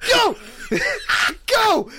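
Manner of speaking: high, loud laughing shout of go; excited high yell; urgent shout. Retold or imitated. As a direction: yell at her to run, laughing. Urging girl to flee.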